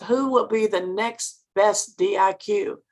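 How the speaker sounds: usual speaking voice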